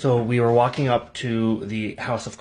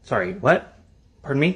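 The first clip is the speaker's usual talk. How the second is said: louder, baffled double-take; acted bafflement, raised voice; 4 semitones higher, 6 dB louder than usual. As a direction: ask it as if you can't have heard right. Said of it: "Me baffled, I'm like".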